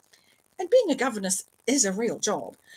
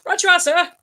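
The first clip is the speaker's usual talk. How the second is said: loud, higher-pitched eager reply; saluting shout; 9 semitones higher, 11 dB louder than usual. A narrator's reading. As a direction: shout it back like a saluting subordinate. Gardener salutes and answers his boss.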